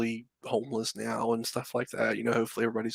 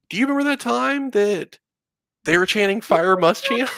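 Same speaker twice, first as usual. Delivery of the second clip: higher, amused wife voice; imitating wife; 9 semitones higher, 4 dB louder than usual. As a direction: play the wife asking with a giggle. Husband voices his wife's question.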